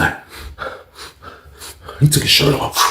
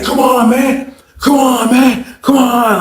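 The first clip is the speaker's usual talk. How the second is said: high, insistent imitation; hyped coaxing; 5 semitones higher, slower than usual. Sweaty hyped cellmate urging him to party, excited mock pleading. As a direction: egg him on with high repeated pleas. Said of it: "he just all fucking hyped".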